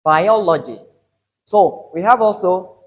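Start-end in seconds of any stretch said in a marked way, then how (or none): 0.0-0.8 "biology" with a loud second syllable O; stress on O. Shown as a demonstration. Teacher repeats the corrected word, O stressed. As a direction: punch the O in "biology", then fall. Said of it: "the stress is on o".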